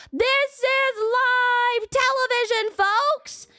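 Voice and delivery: high, slow showman announcer voice; TV host impression. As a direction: stretch it out like a cheesy live-TV host. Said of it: "the presenter guy comes on and he's like".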